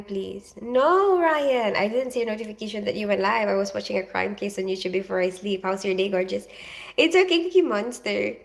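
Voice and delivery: normal talking voice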